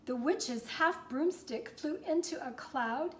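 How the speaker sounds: lively rhyming read-aloud; story narration. A narrator's reading. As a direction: read it to kids, bouncing the rhyme. Reads the picture book aloud.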